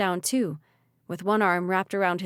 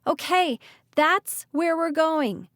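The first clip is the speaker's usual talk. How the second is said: high, loud, slow shouted call; 8 semitones higher, 4 dB louder, slower than usual. Acted. Ridley shouting to Malachi and Callie in the street. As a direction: shout it to the group over the storm. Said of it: "she shouted".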